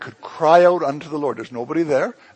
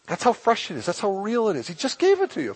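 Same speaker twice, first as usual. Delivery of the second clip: ordinary talk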